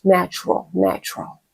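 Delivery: a gentle hushed repeat of "natural"; soft, natural. Shown as a demonstration. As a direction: let "natural" drift away softly. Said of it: "say it the way how it feels".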